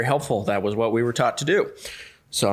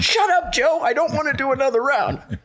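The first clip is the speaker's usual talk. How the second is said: much higher, whining refusal; whiny protest. Retold or imitated. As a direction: whine at the coach like a worn-out kid. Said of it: "He goes".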